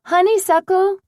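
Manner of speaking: high, loud yell across the house; frantic yell for a missing dog. A narrator's reading. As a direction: call the dog at full voice. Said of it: "Then louder she yelled".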